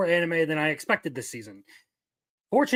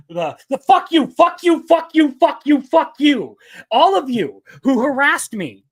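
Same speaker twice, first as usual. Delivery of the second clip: high, loud repeated yelling; shouted rant; 6 semitones higher, 8 dB louder than usual. Pretend. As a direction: yell it at the whole chat for the clip. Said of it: "here's the clip-worthy moment of the night".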